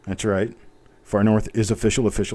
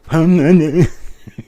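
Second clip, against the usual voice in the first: a burst of laughter, higher and louder; short real laugh; 6 semitones higher, 7 dB louder than usual. Real, not acted. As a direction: laugh at your own clumsiness. Laughs at own bad typing.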